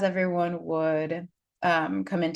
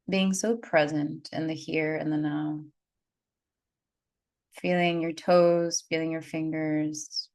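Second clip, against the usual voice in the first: guided meditation. Acted. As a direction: ease listeners into calm breaths. Leads a breathing meditation.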